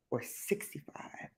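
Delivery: whispered aside, unvoiced and slow. Real, not acted. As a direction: breathe it like a secret.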